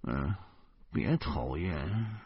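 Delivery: soft, slow, half-asleep grumble; groggy mumble. A narrator's reading. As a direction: drowsily brush them off. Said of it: "迷迷糊糊的接着说". (睡意朦胧地嘟囔)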